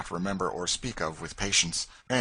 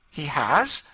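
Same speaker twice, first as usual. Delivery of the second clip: high, loud quick he has; quick surprised question; 9 semitones higher, 9 dB louder than usual. Acted. Lady hearing her brother escaped, quick and surprised. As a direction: snap it out in surprise. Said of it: "said Madame Desault quickly".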